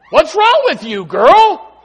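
loud, high mock challenge; teasing call. Pastor playing a churchgoer teasing Kayla, loud. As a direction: call out playfully to her.

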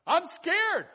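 high, loud scared cry; mock fear. A person spreads fear to others, hammed-up high cry. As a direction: yelp it like a frightened person.

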